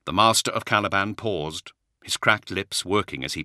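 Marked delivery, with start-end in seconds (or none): none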